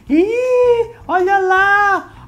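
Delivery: high, loud, drawn-out jeer; mock heckling yell. Street vendors jeering at two hugging men, high stretched yell. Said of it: "os outros ambulantes ao redor começaram a gritar".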